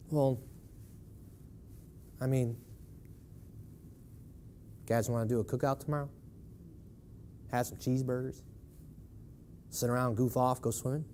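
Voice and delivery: quiet, fast offhand mock invite; hushed mock offhand invitation; mock casual shrug. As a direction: play the careless citizen, quietly. Mocking a lazy holiday attitude.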